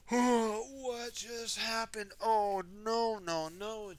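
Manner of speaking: loud, high, slow dismay; Wendy's shocked cry. Acted. Wendy finding the damage, loud high dismay. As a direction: cry out at the broken gnome.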